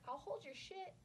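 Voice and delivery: high, laughing through the offer. Real, not acted. Offering to hold a fighter's things, laughing high. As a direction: blurt it out mid-laugh.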